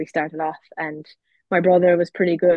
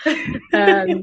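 laughing; 13 semitones higher, 4 dB louder than usual. Real, not acted. Shared laughter over sister.

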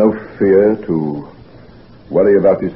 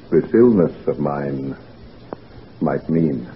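lower, softer, slow and hushed with dread; fearful trailing off; 6 semitones lower, 6 dB quieter, slower than usual. Acted. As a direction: let the dread sink the voice to a murmur. Patient fears his own illness.

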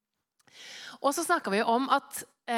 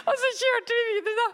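laughing; 11 semitones higher, 5 dB louder than usual. Real, not acted. Still laughing from story.

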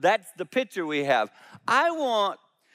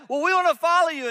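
high, quick, louder eager plea; eager pleading; 11 semitones higher, 4 dB louder, faster than usual. Retold or imitated. Disciples begging Jesus to let them follow, high and fast. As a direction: rush it out like anxious followers.

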